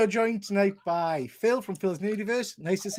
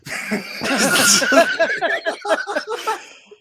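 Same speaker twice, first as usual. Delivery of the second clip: long, high burst of laughter; helpless laughter; 5 dB louder than usual. Real, not acted. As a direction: crack up loudly at your friend. Host cracks up at the guest.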